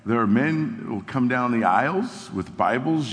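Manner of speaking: normal talking voice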